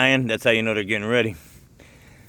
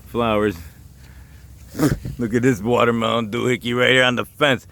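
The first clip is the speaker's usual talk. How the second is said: usual speaking voice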